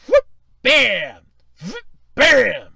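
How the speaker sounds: very loud punched exclamation; boastful reveal. Acted. Console maker showing off to rival, loud boastful bam. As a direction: slam it out like a showy reveal.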